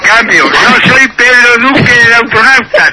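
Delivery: usual speaking voice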